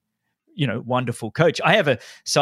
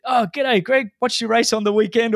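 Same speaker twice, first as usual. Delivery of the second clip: high, lightly put-on Russian accent greeting; friendly Russian-accented hello, playfully imitated; 10 semitones higher than usual. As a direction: mimic Popov's Russian accent, raised pitch. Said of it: "in a very strong Russian accent".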